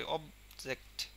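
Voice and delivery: Object, press অব and release জেক্ট; stressed অব, lighter জেক্ট in object; stress on first syllable. Shown as a demonstration. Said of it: "আমরা ছেড়ে দিচ্ছি খুব দ্রুতই". (অবজেক্ট-এ অব-এ জোর, জেক্ট হালকা)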